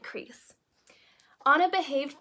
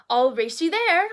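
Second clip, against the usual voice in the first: higher, louder eager challenge; Anna daring her sister to race, a bright louder call; excited call; 5 semitones higher, 6 dB louder than usual. A narrator's reading. Anna challenging Elsa to race, eager and higher. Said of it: "Anna cried".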